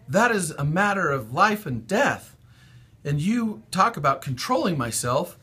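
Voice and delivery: loud, high outraged pleading; angry protest. A narrator's reading. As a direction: rage at the unfairness. Fern yelling at father.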